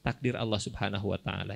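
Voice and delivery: ordinary talk, nothing marked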